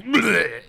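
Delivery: high, loud-ish comic retch; yelled retching bleh. Acted. Vomiting knight yells back, mock retch. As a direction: blurt out a mock vomit noise. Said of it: "He yells back".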